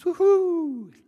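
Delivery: very high, loud woohoo; joyful whoop. Acted. Frog skims across the pond, whooping with joy. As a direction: let out a soaring, sustained whoop. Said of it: "Such joy".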